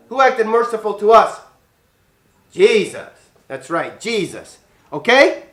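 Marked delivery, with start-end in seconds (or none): none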